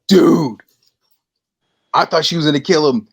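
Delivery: imitating a customer blown away by the scene; excited retelling. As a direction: burst out like a thrilled fan. Customer excitedly reports back.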